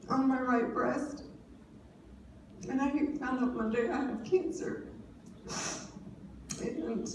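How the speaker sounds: holding back tears while telling it; real emotion breaking through the words; emotional. Real, not acted. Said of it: "I'm gonna get emotional".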